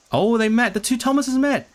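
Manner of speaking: light up as it clicks; thrilled to catch it at last; excited realisation. Real, not acted. Delighted realisation while interpreting.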